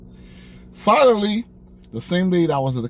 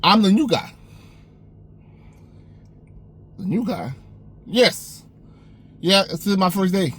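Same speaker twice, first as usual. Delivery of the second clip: confident; 6 semitones higher than usual. Retold or imitated. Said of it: "I said it with the most confidence".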